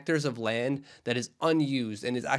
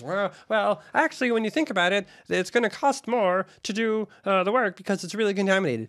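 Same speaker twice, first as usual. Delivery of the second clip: higher-pitched voice of a legal explainer; put-on stock voice; 7 semitones higher, 3 dB louder than usual. Explaining the land costs as legal mumbo jumbo, put-on voice. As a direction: act out the hedging legal talk in a raised, put-on voice. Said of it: "There's that voice again".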